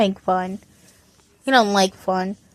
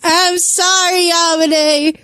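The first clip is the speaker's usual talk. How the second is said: high, drawn-out mock wail; hammed-up crying moan, pitched up; 7 semitones higher, much slower than usual. A narrator's reading. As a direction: wail the apology slowly. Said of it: "Aito wailed".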